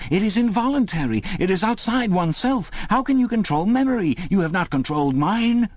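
very high, desperate protest; high, impassioned outcry; anguished cry. A narrator's reading. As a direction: argue frantically, voice pitched right up. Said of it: "cried Winston".

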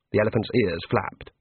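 usual speaking voice

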